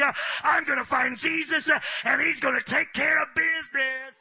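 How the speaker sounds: loud, driving sermon cadence; shouting preacher building to a peak; full-force preaching. Acted.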